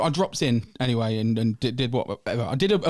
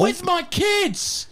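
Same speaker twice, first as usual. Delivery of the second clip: squeaky voice; much slower than usual. Real, not acted. Mock squeaky exchange.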